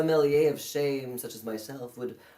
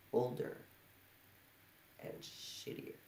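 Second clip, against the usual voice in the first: whispered; 8 semitones lower, 28 dB quieter than usual. Acted. Bitter close of monologue line.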